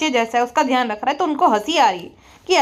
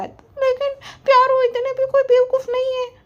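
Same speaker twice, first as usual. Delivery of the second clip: mock vlogger voice; 11 semitones higher than usual. Roaster mocks the vlogger boasting about success, high smug voice. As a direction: puff up and brag in a squeaky put-on voice.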